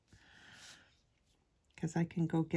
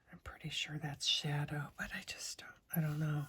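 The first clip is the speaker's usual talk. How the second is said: ASMR whisper. Real, not acted.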